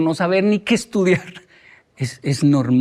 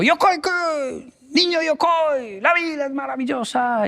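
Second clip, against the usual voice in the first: very high, long drawn-out call; imitated gleeful shout; 10 semitones higher, slower than usual. Teacher spotting his former pupil at the station, high gleeful holler. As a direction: holler from afar like an exuberant teacher, without real volume. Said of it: "él gritó".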